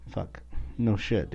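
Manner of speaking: ordinary talk, nothing marked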